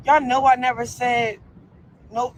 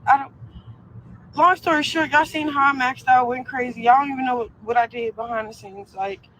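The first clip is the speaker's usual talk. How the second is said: ordinary talk, nothing marked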